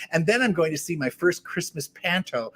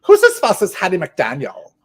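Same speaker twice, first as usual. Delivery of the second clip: mock German tourist; 4 semitones higher, 11 dB louder than usual. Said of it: "they said".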